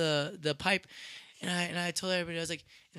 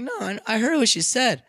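higher, louder pushback; retold indignant retort; 6 semitones higher, 7 dB louder than usual. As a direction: argue back, not having it. Arguing back at the store.

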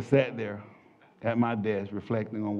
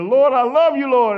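high, loud cry of devotion; screamed praise; 12 semitones higher, 10 dB louder than usual. Retold or imitated. Man praising God alone in the elevator, high screamed devotion. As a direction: praise at the top of your lungs. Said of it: "I'm on the top of my lungs. I'm screaming".

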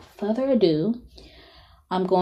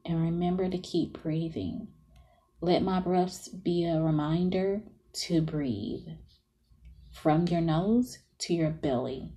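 softer, half-whispered breathing cues; guided meditation; 9 semitones higher, 12 dB quieter than usual. Acted.

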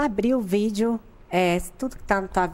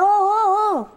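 high, loud call of hey; 11 semitones higher, 6 dB louder, slower than usual. Retold or imitated. The guy calls out to her in the woods, high loud call. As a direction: shout hey across the woods.

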